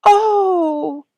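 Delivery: high, loud startled "Oh!"; gasp. A narrator's reading. Daughter gasping at news of her mother. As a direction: gasp it out in a child's shock. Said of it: "Sonya gasped".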